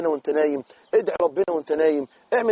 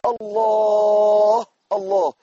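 long, slow, louder ecstatic Allah; drawn-out praise; 4 dB louder, much slower than usual. Shown as a demonstration. Preacher showing how to turn pain into praise, long and loud. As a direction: stretch it out in awe. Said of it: "تقول".

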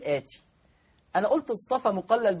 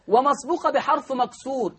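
ordinary talk

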